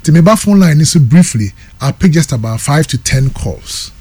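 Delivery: normal talking voice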